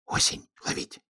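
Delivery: secretive storybook whisper; hushed hedgehog confiding a plan. A narrator's reading. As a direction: whisper it very softly. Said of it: "шёпотом сказал ёжик".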